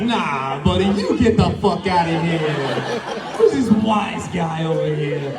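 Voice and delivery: jokingly tossing it back, through a laugh; playful wise-guy banter, laughing; mock tough guy laughing it off. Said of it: "I was like".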